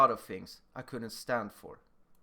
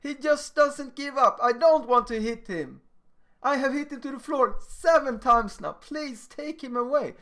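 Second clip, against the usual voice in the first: put-on whiny crying kid; 9 semitones higher, 5 dB louder than usual. A big kid whines to the teacher, high tearful plea. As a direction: beg the teacher like a big kid close to tears. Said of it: "He starts to cry to the teacher".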